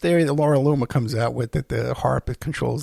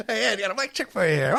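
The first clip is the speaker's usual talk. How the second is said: mock client offer; 7 semitones higher than usual. Mock offer of a blank check.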